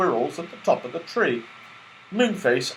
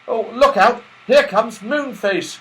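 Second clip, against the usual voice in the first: higher, louder warning call; 9 semitones higher, 6 dB louder than usual. A narrator's reading. Joe warning as Moonface flies out, calling. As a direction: call out to the others. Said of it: "called Joe".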